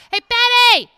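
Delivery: loud, high imitation of husband calling; husband yelling. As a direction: explode into a holler at the wife. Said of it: "he starts calling me Patty Put Away".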